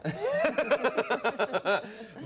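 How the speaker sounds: long high-pitched laugh; laughter. Real, not acted. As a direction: laugh at your own joke. Joking about hoovering in a dress.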